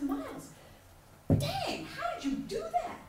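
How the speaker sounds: high, slow amazed question; amazed mom. Retold or imitated. Mom marvels at her son's perfect score, high and slow. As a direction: marvel at him, drawn out. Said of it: "and I said".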